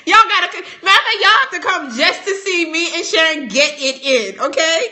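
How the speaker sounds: loud, high, talking through laughter; laughing. Real, not acted. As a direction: giggle through the invite. Laughing while inviting.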